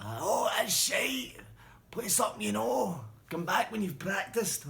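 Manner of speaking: high, loud, slow jeering heckle; heckling shout. Retold or imitated. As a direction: jeer the band from the bar. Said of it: "he'd be shouting at folk".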